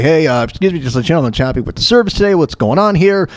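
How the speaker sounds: ordinary delivery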